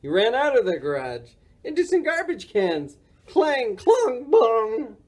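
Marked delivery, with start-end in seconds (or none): 3.3-5.0 high, ringing clatter words; crashing sounds. A narrator's reading. Morris hitting garbage cans, resounding crash noises. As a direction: make each word resound like struck metal.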